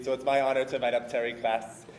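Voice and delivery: ordinary delivery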